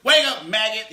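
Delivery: loud, barked drill sergeant order. Retold or imitated. Drill sergeant shouting at a recruit, loud bark. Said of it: "He's not the drill sergeant".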